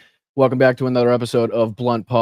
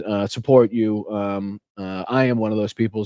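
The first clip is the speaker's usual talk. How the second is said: usual speaking voice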